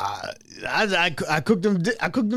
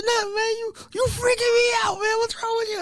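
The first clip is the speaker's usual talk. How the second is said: much higher, whiny crying mock panic; freaked-out squeak; 4 dB quieter than usual. [name] saying Mike is freaking him out, mock whiny panic. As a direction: sound spooked, almost wailing.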